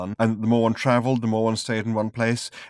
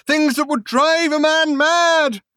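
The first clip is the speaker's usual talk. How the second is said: high, louder and slow; raving shout; 9 dB louder, slower than usual. Acted. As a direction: drag out the madness in a shout. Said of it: "he shouted".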